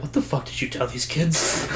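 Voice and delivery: soft, under-the-breath demand; quiet mock scolding; imitated hushed confrontation. Confiding, arm around a kid.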